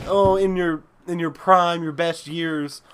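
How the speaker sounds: ordinary delivery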